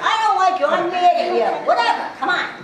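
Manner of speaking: loud, high play-acted anger, laughing through it; mock anger. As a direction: pretend to be mad, half laughing. Role-play confrontation with student.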